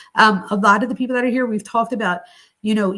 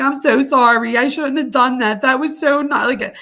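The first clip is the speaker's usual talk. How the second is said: higher, louder, gushing sorry; guilty apology; 5 semitones higher, 6 dB louder than usual. Retold or imitated. Mom apologizing to daughter, guilty and gushing. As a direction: pour out the apology, pitched up and pressing.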